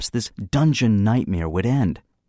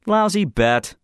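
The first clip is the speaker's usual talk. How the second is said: offhanded; 12 semitones higher, slower than usual. A narrator's reading. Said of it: "I said offhandedly".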